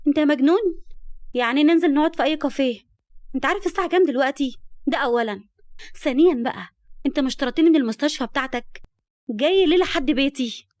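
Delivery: high, loud incredulous scolding; irritated, worked up. A narrator's reading. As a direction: snap at him for turning up at night. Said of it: "اتنرفزت جدًا وقالت بعصبية".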